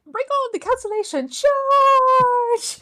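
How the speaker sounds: loud, high rallying cry with the last word held long; mock battle cry. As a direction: yell it like leading a charge.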